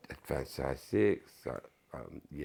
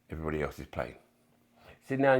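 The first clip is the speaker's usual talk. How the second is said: ordinary talk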